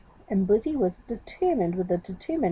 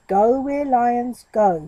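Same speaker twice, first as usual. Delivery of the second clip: ordinary delivery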